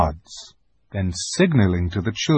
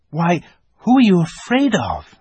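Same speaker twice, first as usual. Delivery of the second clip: put-on boy voice; 9 semitones higher, 4 dB louder than usual.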